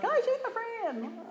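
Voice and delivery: mock-sweet, high and stretched; fake friendliness. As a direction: gush it in a sing-song high voice. Mimics a fake friendly greeting.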